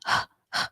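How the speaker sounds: breathy unvoiced H sound, just air; teacher sounding out the letter H. Shown as a demonstration. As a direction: push out a whispered H. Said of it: "this letter is H, has the H sound".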